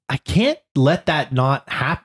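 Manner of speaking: ordinary talk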